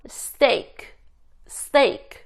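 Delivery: "steak" with hard, unaspirated t; tense the t after s in "steak". Shown as a demonstration. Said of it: "이렇게 된소리가 난다".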